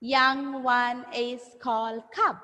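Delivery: slow, evenly spaced teacher modelling; slow repeat-after-me. Shown as a demonstration. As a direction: say it word by word for pupils. Model sentence for pupils to repeat.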